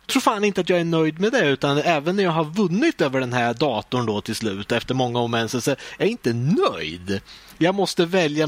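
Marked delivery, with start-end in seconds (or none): none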